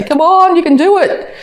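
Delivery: high-pitched, eager pep-talk voice; a fired-up friend cheering you off the couch, eager and high; enthusiastic cheer. Retold or imitated. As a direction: cheer them on like an excited friend. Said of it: "come in all enthusiastic and say".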